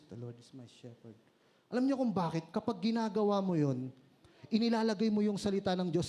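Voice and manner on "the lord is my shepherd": silent, breath-only whisper; voiceless whisper. Shown as a demonstration. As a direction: mouth it with no voice at all. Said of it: "tahimik kahit walang boses".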